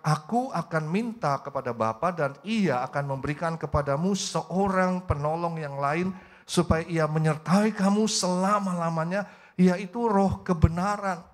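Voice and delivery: slow, loud, weighty verse reading; drawn-out preacher's recitation, voice rising in force; emphatic scripture reading. Real, not acted. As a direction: proclaim the verse word by word. Reads a Bible verse aloud.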